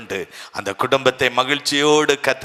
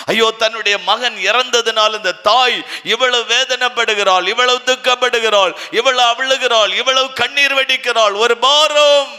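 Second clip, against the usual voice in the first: impassioned preaching; 8 semitones higher than usual. Acted. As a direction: cry it out to the congregation, rising. Preacher pleads over grieving mother.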